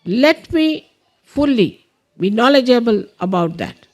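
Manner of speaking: deliberate prayer leader with long pauses; measured meditation instructions with space to follow; guided meditation. Real, not acted. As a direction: guide them through it, phrase by phrase.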